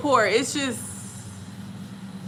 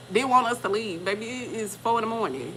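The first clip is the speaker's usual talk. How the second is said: normal talking voice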